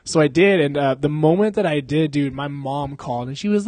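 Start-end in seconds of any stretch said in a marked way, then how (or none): none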